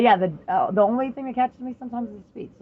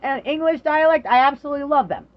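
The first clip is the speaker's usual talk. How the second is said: excited loud rant; 4 semitones higher, 6 dB louder than usual. Real, not acted. Excited rant about Canadians.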